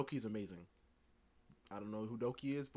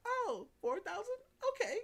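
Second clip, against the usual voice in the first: mock surprise; 3 dB louder than usual. Doki hearing four thousand from the artist, high mock-surprised voice. Retold Doki reacting to the price.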